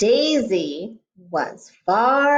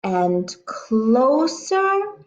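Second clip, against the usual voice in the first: building suspense; 3 semitones higher, slower than usual. Acted.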